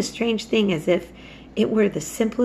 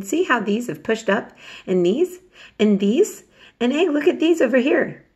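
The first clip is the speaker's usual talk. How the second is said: rising, eager pointing out; a boy excitedly showing off new shoots; eager Dickon; 5 semitones higher, 4 dB louder than usual. A narrator's reading. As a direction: keep topping yourself as you point each one out.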